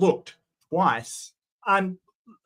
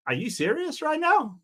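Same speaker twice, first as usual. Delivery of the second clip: incredulous; 12 semitones higher than usual. Real, not acted. Reacting to the preacher's hypocrisy.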